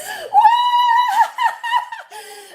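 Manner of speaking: very high, loud whoop with laughter; real high-pitched squeal of joy; excited shriek. Real, not acted. Reacts to reaching subscriber milestone.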